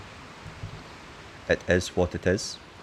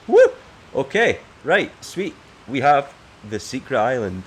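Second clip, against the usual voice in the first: high, loud excited cheer; 6 semitones higher, 6 dB louder than usual. Real, not acted. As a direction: whoop with delight. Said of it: "Very happy".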